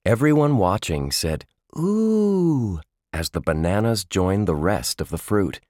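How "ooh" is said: high drawn-out ooh; wowed by the juggling; impressed ooh. A narrator's reading. Crowd watching the juggling.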